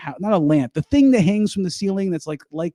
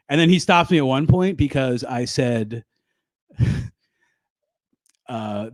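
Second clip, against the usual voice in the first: ordinary talk, nothing marked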